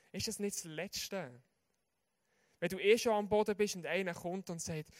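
ordinary talk, nothing marked

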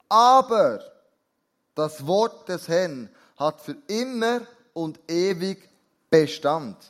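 emphatic, measured Bible reading; scripture reading. Acted. Preacher reads scripture to the congregation, emphatic and measured. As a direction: proclaim it slowly, leaning on key words.

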